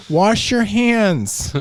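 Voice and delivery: very slow, loud; drawn out mock lecture; slow loud advice. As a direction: stretch each word loudly. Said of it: "I was like".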